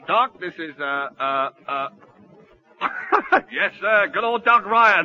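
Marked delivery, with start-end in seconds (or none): 2.8-3.5 nervous laugh. Acted. Man bluffing introduction.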